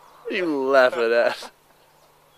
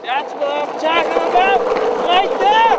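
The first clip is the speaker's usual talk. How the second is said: excited squeal; 13 semitones higher, 5 dB louder than usual. Pretend. Excited exclamation, very high.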